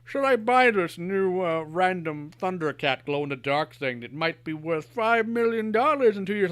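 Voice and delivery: mock collector musing. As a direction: weigh it like a greedy investor, slowly. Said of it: "I don't sit there and think".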